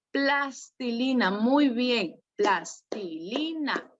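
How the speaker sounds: put-on "Plastilina" said slowly, syllable by syllable, for the child; slow model word. Shown as a demonstration. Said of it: "A ver, dilo tú".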